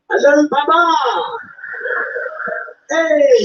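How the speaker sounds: loud, sing-song imitation African accent, words drawn out; mock African accent. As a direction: hail them in a hammed-up African accent, stretching line endings.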